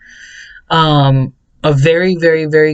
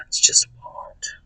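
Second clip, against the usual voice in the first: whispered aside; 8 dB quieter than usual. Real, not acted. Vlogger whispering a confession about Walmart. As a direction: breathe it out under the breath.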